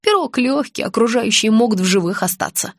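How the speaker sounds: ordinary delivery, nothing marked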